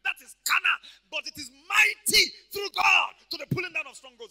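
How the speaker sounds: high, full-voiced shouted proclamation; fervent pulpit shout; impassioned preaching. Real, not acted. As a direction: thunder it out to the congregation. Preaching climax at full force.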